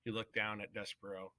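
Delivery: plain reading voice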